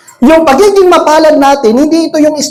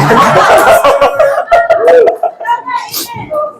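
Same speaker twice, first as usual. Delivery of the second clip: long, high burst of laughter. Real, not acted. Congregation slow to answer.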